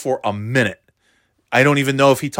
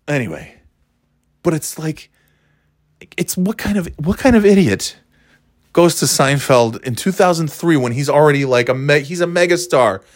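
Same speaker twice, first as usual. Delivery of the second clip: ordinary delivery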